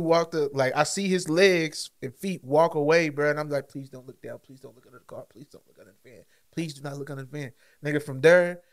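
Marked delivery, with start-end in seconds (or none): 3.7-7.5 much softer, hushed begging; pleading whisper. Retold or imitated. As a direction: whisper it so he won't hear. Hiding under van, begging.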